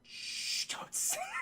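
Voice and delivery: soft shushing whisper; hushing whisper. Retold or imitated. Leader shushes the lost man, whispering. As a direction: hush him in a whisper. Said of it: "Adrian Brody goes".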